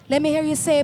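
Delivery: ordinary delivery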